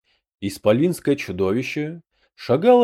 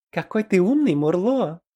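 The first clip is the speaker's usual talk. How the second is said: high-pitched admiring goblin voice; 8 semitones higher than usual. A narrator's reading. Мымра to Мурло after his objection, admiring. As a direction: gush in a much higher character voice. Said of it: "восхитилась Мымра".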